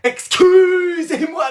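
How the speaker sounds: very high, long-stretched attention call; high, slow, insistent call; drawn-out hail. Acted. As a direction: sing out the middle vowel, high and held. Student hails teacher with drawn-out call.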